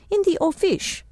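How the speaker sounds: a hint of French accent, lifted high; light French accent. Sylvie explaining where the tiger lived, light French accent. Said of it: "in her charming French accent".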